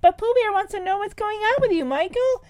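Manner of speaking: put-on high, fast little-girl voice; high fast kid sister begging. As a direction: play a pleading small girl. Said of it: "she's like".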